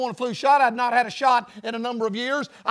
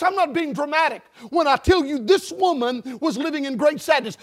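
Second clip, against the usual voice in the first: ordinary talk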